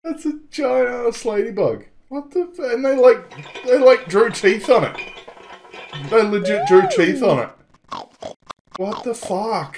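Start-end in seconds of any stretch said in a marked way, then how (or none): 2.1-9.8 incredulous. Real, not acted. Shocked at ladybug drawing.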